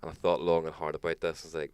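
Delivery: ordinary talk, nothing marked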